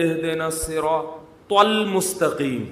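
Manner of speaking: slow المستقیم with the ط sounded heavily; mock imam proud of one letter; slow mock recitation; overdone ط. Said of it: "جہاں تو آئے گی".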